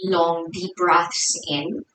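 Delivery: soft, hushed meditation guidance with long pauses; guided meditation. Real, not acted. Yoga teacher settling the class into their breath, soft guided meditation. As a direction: ease them inwards, softly and unhurried.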